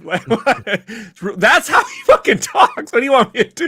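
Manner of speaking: high, loud mock-indignant protest; hammed-up offended shouting through laughs; mock outrage. Defending his Star Wars voice to the cohosts, in hammed-up outrage.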